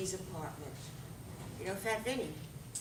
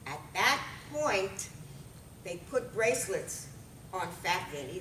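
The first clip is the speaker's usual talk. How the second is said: usual speaking voice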